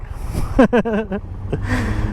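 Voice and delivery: short embarrassed chuckle. Real, not acted. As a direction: laugh off his own joke. Joke about his weight.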